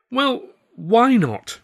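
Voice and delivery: high, loud, drawn-out plea; incredulous why not. Acted. Arthur begs Tilly to explain her refusal, high and slow. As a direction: stretch it out in dismay.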